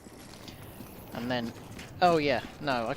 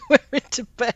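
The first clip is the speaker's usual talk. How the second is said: laughing; 10 semitones higher, 9 dB louder than usual. Real, not acted. Laughing at surprise game scene.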